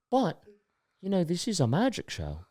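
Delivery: put-on the performer's accent, soft and drawn out at the end; quiet showman's tease in a put-on accent; Cheese Man accent. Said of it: "I gotta do his accent".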